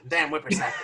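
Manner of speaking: put-on cranky old coot shouting at the kids; grumpy old-timer yelling; old prospector. Grumbling about the younger generation.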